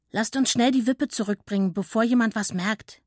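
usual speaking voice

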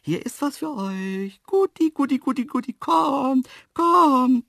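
high, loud sing-song luring call; trusting coaxing call. A narrator's reading. Narrator luring the antelopes at the enclosure, coaxing. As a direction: coax shy animals in a high, sweet call. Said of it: "lockte ich mit zutraulicher Stimme".

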